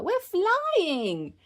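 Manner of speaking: high, soaring cry; gleeful high-pitched exclamation; exhilarated child. Acted. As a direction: sing out the thrill in a high voice. Peppa exulting in flight.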